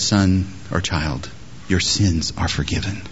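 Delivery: soft, low and gentle; a quiet loving pardon; tender. Acted. Jesus gently telling the paralytic his sins are forgiven, soft and low.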